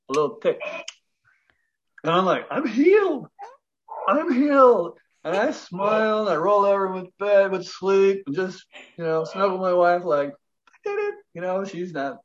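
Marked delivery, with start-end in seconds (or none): 2.5-3.3 high, elated exclamation; bursting with amazed delight; thrilled realization. Retold or imitated. Realizes tooth healed at night. 4.1-5.0 high and a bit louder, sure now; triumphant second cry of relief; louder joyful repeat. Retold or imitated. Repeats the realization. 10.3-11.2 whispered triumph. Retold or imitated. Snuggling sleeping wife.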